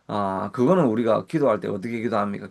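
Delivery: ordinary delivery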